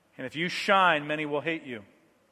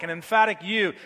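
ordinary delivery

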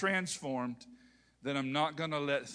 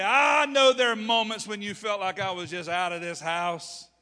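mock wife complaint; 5 semitones higher, 8 dB louder than usual. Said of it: "She didn't say".

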